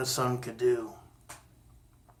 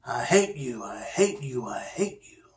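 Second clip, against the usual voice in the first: whispered echo, fading away; the cave echoing the boy's words back; 3 semitones lower, 5 dB quieter than usual. Acted. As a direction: let each repeat die off like a cave echo. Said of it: "and he heard".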